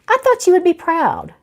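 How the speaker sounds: put-on high, childlike pout. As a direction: sulk like a hurt child. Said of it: "She thrust out her lower lip".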